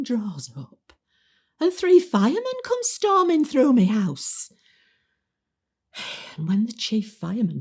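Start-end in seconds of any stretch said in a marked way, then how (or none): 1.6-4.5 high, raised-voice comic storytelling; dramatic excitement. Acted. Resident describing firemen storming in, high and excited. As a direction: build the drama of the intrusion.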